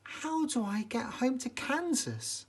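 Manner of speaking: put-on high girlish plea; a lost girl wanting home; young girl asking. A narrator's reading. Lost Dorothy asking the way home, high and girlish. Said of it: "asked Dorothy".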